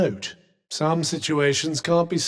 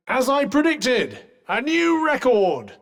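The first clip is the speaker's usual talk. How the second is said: higher-pitched triumphant announcement; 8 semitones higher than usual. Acted. Companion crowing over a predicted record, triumphant. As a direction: proudly proclaim it, pitch lifted high.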